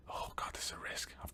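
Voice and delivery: whisper. Real, not acted. Nervous aside while buying property.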